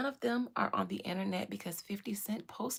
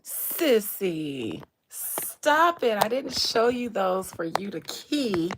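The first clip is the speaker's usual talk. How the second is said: loud scolding; 8 dB louder, much slower than usual. Real, not acted. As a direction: yell at her to stop it. Scolding someone laughing at memes.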